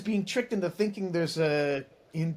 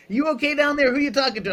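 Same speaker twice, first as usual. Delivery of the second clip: higher and louder, calling down the stairs; wife calling down; 9 semitones higher, 5 dB louder than usual. Retold or imitated. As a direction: call out like a curious wife.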